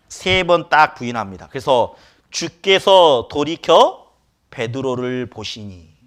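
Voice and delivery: normal talking voice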